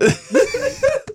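high burst of laughter; shrieking laugh. Real, not acted. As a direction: let out a squeal of a laugh. Laughs at the skydiving story.

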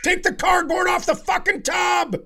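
high, loud exasperated yell, laughing; mock angry shout. Imagining plumbers arguing over the tub, a mock angry shout. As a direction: bark it like a fed-up plumber.

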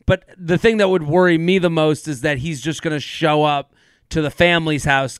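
normal talking voice

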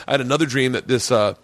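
ordinary talk

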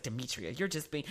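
low, soft imitation of mother; quiet retold protest. Mother objecting to daughter's scolding, low and quiet. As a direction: drop the voice well down, as if objecting weakly.

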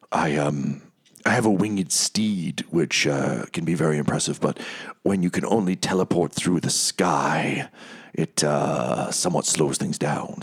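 low, halting, apologetic voice; awkward Haruman. Acted. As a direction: make a sheepish excuse with hesitant pauses. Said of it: "comes over awkwardly and he's like".

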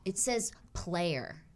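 soft, slow deadpan punchline; deadpan reveal. Real, not acted. Host revealing the gynecologist's license plate, deadpan. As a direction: let it drop quietly and slowly.

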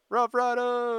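high, loud hype call; hype shout. Pretend. Hyping the hit song title.

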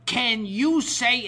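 slow, high and loud, word by word; emphatic retold request. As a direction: raise it as if for someone hard of hearing. Fan repeating himself to deaf caller.

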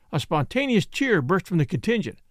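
ordinary delivery

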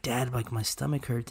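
soft, weak imitation of a sick boy; a touch of a hurting child, quietly; sick kid. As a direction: son with a stomachache, softly imitated. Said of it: "And he's holding his stomach".

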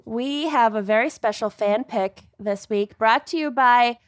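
ordinary delivery

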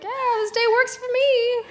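high, chirpy casual yes; breezy fake calm. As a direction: sound cheerfully unbothered. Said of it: "I'm like".

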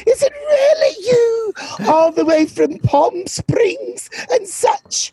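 high, drawn-out theatrical gush; mock-gushing greeting. Guest greeting host from Palm Springs, hammed-up high gushing. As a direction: greet them in a slow, swooping high voice.

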